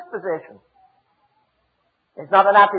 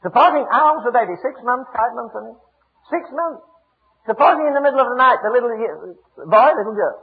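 ordinary delivery

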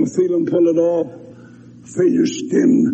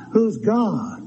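scoffing high laugh; sneering mock laughter; 11 semitones higher, 4 dB quieter than usual. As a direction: laugh God off like a skeptic. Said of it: "you laugh".

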